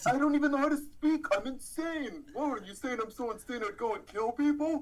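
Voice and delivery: high-pitched mock-crazed protest; protesting suspect. Co-host joining the suspect's defence, high mock-indignant voice. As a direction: plead insanity in a high comic voice.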